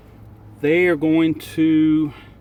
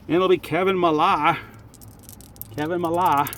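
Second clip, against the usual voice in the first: put-on New England accent; 3 semitones higher than usual. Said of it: "New England accent is not very good".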